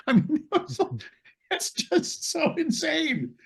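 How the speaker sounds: real laughter breaking the line; laughing. Real, not acted. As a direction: giggle it out in disbelief. Laughing recalling scene.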